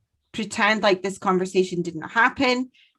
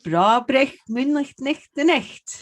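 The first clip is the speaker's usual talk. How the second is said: Scottish dialect phrase; 2 semitones higher than usual. Shown as a demonstration. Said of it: "Say it one more time".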